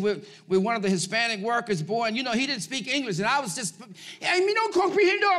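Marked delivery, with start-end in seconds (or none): 4.2-5.4 mocking a worker's broken Spanish, pitched up; hammed-up "me no comprehendo". Mocking worker who can't understand.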